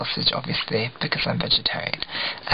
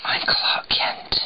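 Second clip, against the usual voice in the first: slow, whispered pretend reveal; mock secret whisper; much slower than usual. Vlogger in glasses as a disguise, mock secret whisper. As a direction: whisper it slowly like a secret identity.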